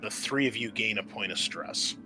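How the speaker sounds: usual speaking voice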